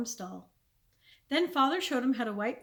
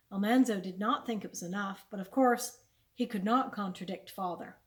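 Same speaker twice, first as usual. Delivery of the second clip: plain reading voice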